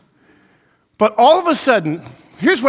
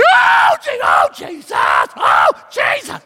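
high, loud panicked cries of Jesus; mock panic; 5 dB louder, much slower than usual. Preacher acting the panic he didn't feel in the crash. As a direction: shriek it like the car is going over. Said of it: "rather than panicking".

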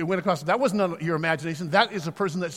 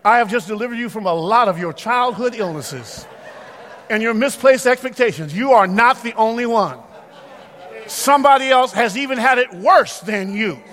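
loud, high, ringing sermon delivery; full-force preaching; 8 semitones higher, 12 dB louder than usual. Acted. Pastor exhorting the church in a loud preaching voice. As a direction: preach it to the congregation at full power.

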